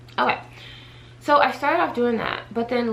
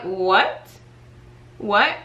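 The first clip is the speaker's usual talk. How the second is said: retold disbelief; 4 dB louder than usual. Vlogger retelling her reaction to news of a pay cut, incredulous. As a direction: act out the shock of hearing about a pay cut.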